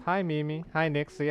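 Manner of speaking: usual speaking voice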